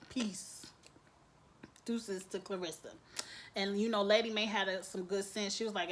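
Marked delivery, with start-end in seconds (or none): none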